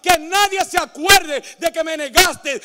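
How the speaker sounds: loud, slow, ringing proclamation; preaching at full force. Acted. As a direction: build it up and cry it out to the church.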